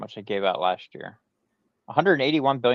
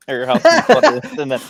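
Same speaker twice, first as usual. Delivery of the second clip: laughing; 11 semitones higher, 10 dB louder than usual. Real, not acted. Laughing through a dick joke.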